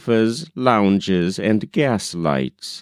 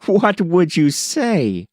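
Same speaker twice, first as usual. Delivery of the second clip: higher, excited exclamation; excited cry; 9 semitones higher than usual. A narrator's reading. Rebecca crying out to tease Tom, excited and higher. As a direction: cry it out eagerly. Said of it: "cried she".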